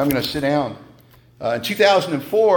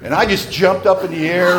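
ordinary talk

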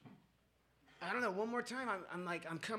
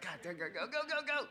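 willing the clock to move, building higher; impatient urging; 4 semitones higher than usual. Retold or imitated. Drinker watching the clock before eleven, impatient pleading.

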